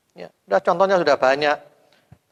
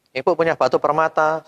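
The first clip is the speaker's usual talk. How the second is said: normal talking voice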